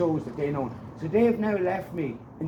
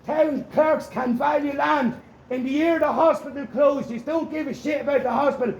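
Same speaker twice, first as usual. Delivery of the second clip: angry public shouting; 5 semitones higher than usual. Real, not acted. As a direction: yell accusations after departing officers, drawing out the words. Shouting after departing Gardaí.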